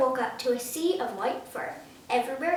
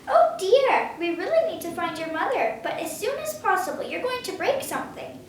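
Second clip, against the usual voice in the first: fretful scolding; 6 semitones higher, 4 dB louder than usual. A narrator's reading. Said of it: "scolded Otto".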